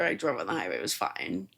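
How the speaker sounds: ordinary talk, nothing marked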